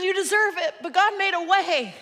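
loud, high insistent preaching; screaming match. Acted. Norm pressing the gospel on the stranger, screaming. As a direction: keep yelling the point home. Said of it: "they begin a screaming match".

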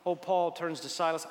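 normal talking voice

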